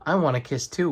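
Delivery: ordinary delivery, nothing marked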